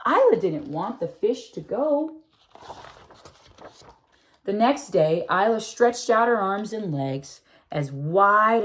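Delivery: put-on gentle read-aloud for children, telling the tale; storybook reading. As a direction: read it like a bedtime picture book. Reading picture book aloud.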